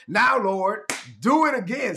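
high, ringing cry to the Lord; fervent prayer. Acted. Pastor crying out to the Lord in prayer, high and fervent. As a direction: lift it up to God, pleading.

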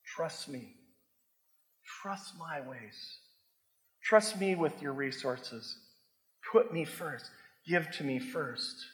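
slow, hushed, tender plea; soft voice of God. Retold or imitated. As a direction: gently whisper God's invitation. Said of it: "God is saying".

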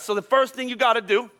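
usual speaking voice

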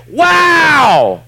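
huge, high, drawn-out exclamation; booming, soaring wow; awestruck shout. Acted. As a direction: cry out in overwhelming amazement. Acts out being amazed by God.

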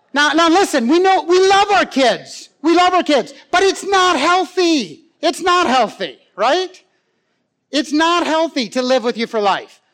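high, forceful pulpit plea; emphatic preaching. Real, not acted. Preacher insisting to parents about grown children, emphatic preaching. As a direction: drive it home, stretching the key words.

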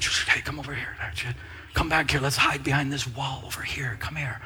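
low, hushed, secretive beckoning; a furtive whisper luring someone behind a wall; hushed drug deal. Retold or imitated. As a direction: call them over quietly so nobody hears.

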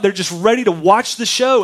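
ordinary talk, nothing marked

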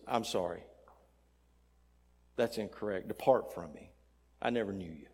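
low, soft verdict from behind the gate; solemn refusal. Acted. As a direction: turn him away quietly and finally. Voice at gate turns him away.

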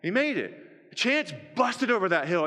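usual speaking voice

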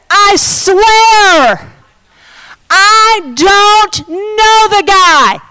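high, drawn-out shouted oath; emphatic sworn denial. Acted. Peter denying Jesus with an oath, emphatic shouting. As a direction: swear it loudly, stretching each word. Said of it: "he denied it with an oath".

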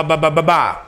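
ordinary delivery

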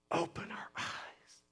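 hushed, pleading whisper; whispered prayer. Acted. Preacher praying for the congregation, whispered. Prayer voiced mid-sermon.

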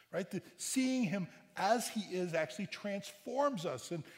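normal talking voice

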